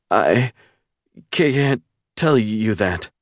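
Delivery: put-on a scared young woman stammering to a stranger; frightened stammer. A narrator's reading. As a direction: stumble over the words in fear. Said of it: "she stammered".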